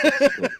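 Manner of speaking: high burst of laughter. Real, not acted. Laughs at joke.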